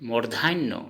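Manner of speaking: ordinary talk, nothing marked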